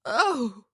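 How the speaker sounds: loud, high pained groan. A narrator's reading. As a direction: cry out as if hurt. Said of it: "as if she were in pain".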